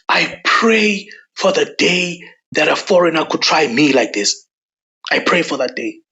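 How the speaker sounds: hushed and threatening; whispered threat, no voice at all; menacing whisper. Real, not acted. Same prayer, now whispered.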